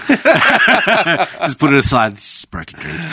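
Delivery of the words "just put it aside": slow, laughing through the words. Real, not acted.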